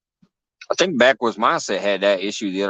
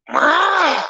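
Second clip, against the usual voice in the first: high squawking bird cry; pterodactyl shriek. Retold or imitated. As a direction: screech like a prehistoric bird. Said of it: "they're like".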